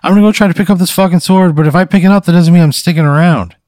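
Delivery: defiant declaration. Acted. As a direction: push back hard on the townsfolk. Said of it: "Yeah, I go".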